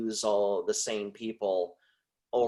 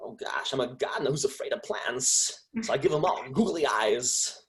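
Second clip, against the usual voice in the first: oddly phrased celebrity impression; Christopher Walken. Shown as a demonstration. Improvising a silly gardener line, quirky actor impression. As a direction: play it as a quirky actor with halting stresses. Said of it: "the Christopher Walken".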